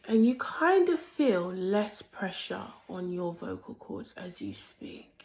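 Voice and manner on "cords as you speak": low, breathy voice that shakes; shaky vibrating voice. Shown as a demonstration. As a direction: let the voice tremble softly. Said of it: "your voice is vibrating like this".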